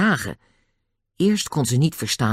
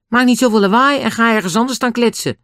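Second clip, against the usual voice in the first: high, raised voice telling off; scolding; 11 semitones higher, 5 dB louder than usual. A narrator's reading. Julian tells two noisy men off, loud scolding. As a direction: scold them loudly.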